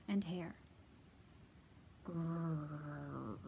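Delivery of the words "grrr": low rumbling growl; bear growl. Acted. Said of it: "growled at his neighbors".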